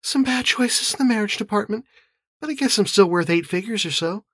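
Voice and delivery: high, light female character voice; rueful sister. A narrator's reading. Anna owns up to bad marriages, rueful. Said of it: "His sister grimaced".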